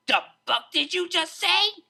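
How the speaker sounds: loud, high outraged bark; furious. A narrator's reading. DJ furious at Octavia's jab at DJs. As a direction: explode at the insult. DJ insulted, explodes.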